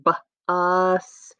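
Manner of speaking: "B, us" split after the B, vowel stretched; segmented word. Shown as a demonstration. Teacher showing three sounds in bus, segmented word. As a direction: sound out "B, us" slowly with a gap after B. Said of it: "That's the word bus".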